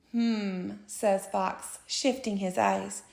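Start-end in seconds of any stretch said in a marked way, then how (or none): 0.1-1.8 low, soft sly murmur; quiet. A narrator's reading. Sly fox considers.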